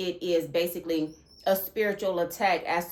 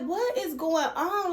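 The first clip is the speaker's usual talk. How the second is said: high-pitched alarmed question; bewildered; 9 semitones higher than usual. Retold or imitated. Waking up to a strange thought, alarmed and high. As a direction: ask it in sudden alarm, voice up high. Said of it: "I'm like".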